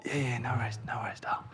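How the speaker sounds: soft, drowsy reassurance; sleepy murmur. Retold or imitated. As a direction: murmur it half asleep. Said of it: "And I do the whole".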